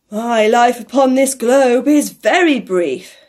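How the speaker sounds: loud, slow ghost voice; ghostly pronouncement. A narrator's reading. The ghost tells Scrooge of its short life, loud and slow. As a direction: proclaim it slowly as a great spirit.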